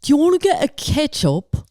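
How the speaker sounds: a cheesy Australian guy making a move; bad Australian accent drifting toward British. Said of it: "I can't do an Australian accent".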